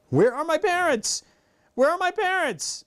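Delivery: high anxious repeated question; searching the crowd in a high fret; panicked diva. Retold or imitated. Girl retelling stage fright, mock panicky high voice. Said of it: "So I was like".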